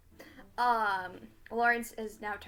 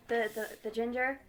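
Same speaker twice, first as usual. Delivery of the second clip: ordinary delivery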